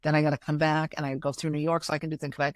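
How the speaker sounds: quick-fire list of travel demands; brisk briefing to an assistant, hurried along; rattled-off instructions. Retold or imitated. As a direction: reel off the itinerary fast.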